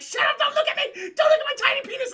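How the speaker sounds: high, loud hysterical shriek, hammed-up; mock screaming. Humiliated cult leader begging her not to look, mock screaming. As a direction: screech it as a wounded-pride tantrum. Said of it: "And just screaming".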